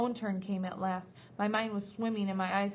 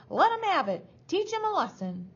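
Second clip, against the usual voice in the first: high, loud, gleeful-villain screech; piercing egging-on; shrieking; 10 semitones higher, 6 dB louder than usual. A narrator's reading. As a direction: shriek to urge on the beating. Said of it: "she was shrieking".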